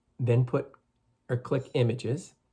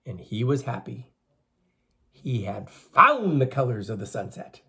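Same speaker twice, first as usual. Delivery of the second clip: slow, expressive storyteller voice; story reading for children; 7 dB louder than usual. A narrator's reading. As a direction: read the legend to the class, drawn out. Teacher reading legend to children.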